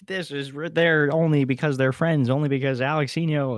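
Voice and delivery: scornful imitation of fan comments; imagined commenter. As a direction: sneer like an online commenter. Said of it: "It's like".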